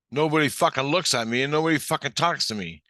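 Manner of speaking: loud, high, exasperated protest; frustrated outburst. Retold or imitated. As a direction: shout up in frustration, retelling it. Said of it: "frustrated the hell out of me".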